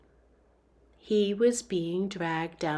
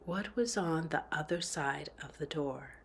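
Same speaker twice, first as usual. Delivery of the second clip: hushed tense whisper; 5 semitones lower, 11 dB quieter than usual. A narrator's reading. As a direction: breathe the question in a whisper. Narrator whispers tense inner question.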